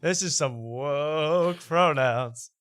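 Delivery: slow, drawn-out, exaggerated scoff; mock indignation, slowly drawn out; Jeff Dye impression. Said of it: "my best Jeff Dye impression".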